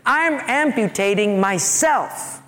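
normal talking voice

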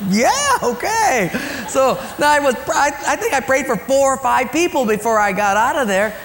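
very high, loud amused yeah okay; amused excitement. Real, not acted. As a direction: act out a baffled but happy yes. Laughing at the growing requests.

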